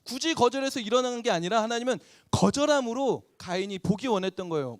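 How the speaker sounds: ordinary talk, nothing marked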